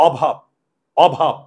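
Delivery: অভাব said "ô-bhab", ending on the b; অভাব with no vowel after the last ব; silent final vowel. Shown as a demonstration. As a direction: cut অভাব off at the closed b. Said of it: "এই অয়ের উচ্চারণটা হয় না".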